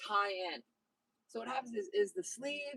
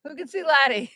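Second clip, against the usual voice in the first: louder call; 4 semitones higher, 13 dB louder than usual. Real, not acted. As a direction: call it out to the viewers. Calling out to viewers.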